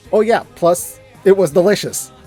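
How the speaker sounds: normal talking voice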